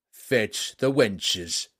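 slow, lusty command. A narrator's reading. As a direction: order it like a rowdy king. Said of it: "he commanded".

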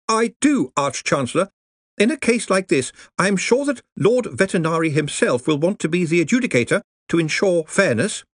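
anxious junior wizard, careful; nervous young wizard. Acted. Ponder answers Ridcully.

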